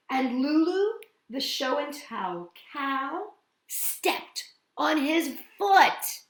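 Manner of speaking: ordinary delivery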